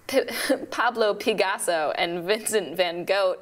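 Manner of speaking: giggling through the punchline; laughing. Real, not acted. Telling joke to puppet, laughing through answer. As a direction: laugh as you deliver it.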